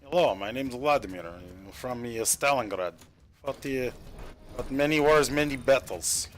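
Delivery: halting, put-on Russian accent; pretend Russian accent. Shown as a demonstration. Streamer showing viewers his pretend Russian, mock Russian accent. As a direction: play Vladimir with a hammed-up Russian accent. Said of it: "a pretend Russian accent".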